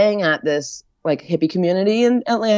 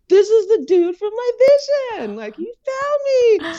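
high, stunned realization; awed realization. Retold or imitated. Recognizing the witch doctor from her vision, high awed realization. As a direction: gasp it out in sudden awe.

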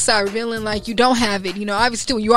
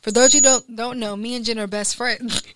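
ordinary talk

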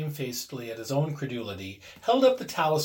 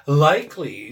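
pretended horror; 8 dB louder than usual. A narrator's reading. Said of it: "said Herbert with pretended horror".

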